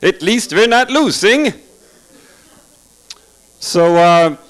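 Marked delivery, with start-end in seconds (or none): 0.0-1.5 higher, slow, dazed soldier muttering hun hun; a grunt trying to keep morale up; soldier at the front. Acted. Soldier at the front line, slow dazed grunting.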